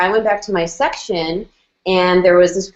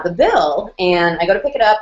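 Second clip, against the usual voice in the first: ordinary talk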